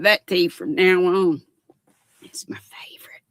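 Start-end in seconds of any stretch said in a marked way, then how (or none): 2.2-3.2 soft secret whisper, barely any voice. Real, not acted. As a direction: lean in and whisper it.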